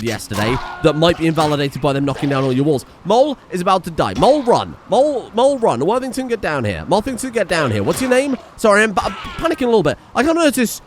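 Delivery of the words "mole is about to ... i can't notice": high, swooping urgent calls; mildly panicked. Real, not acted. Player ordering a dying fighter to run, mildly panicked. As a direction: call out orders in a light panic.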